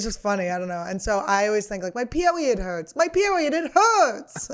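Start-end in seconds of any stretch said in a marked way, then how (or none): 1.9-4.5 put-on high toddler whine; whiny toddler. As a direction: whimper it like a small child. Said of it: "and it goes".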